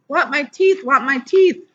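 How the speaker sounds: put-on high wailing little boy; high child's tearful scream; toddler screaming. A narrator's reading. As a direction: demand it like a hurt small child. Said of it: "Fudgy started to scream".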